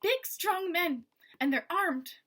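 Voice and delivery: urgent hushed Eleanor. Acted. Eleanor warning Maggie about armed men, tense urgent voice. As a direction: warn the girl urgently. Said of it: "Eleanor whispered".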